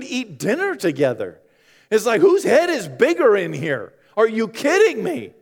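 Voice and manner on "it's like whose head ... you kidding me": ordinary talk, nothing marked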